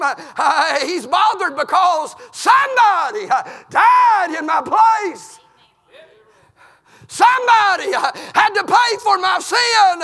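preacher crying out a confession; fervent preaching. Acted. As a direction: pour out the guilt from the pulpit. Preaching at full force.

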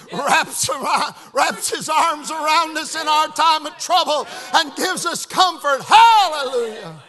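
loud, stretched-out preaching; full-force preaching. Real, not acted. Preacher rejoices in God's comfort, full-force preaching. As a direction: shout the praise, drawing words out.

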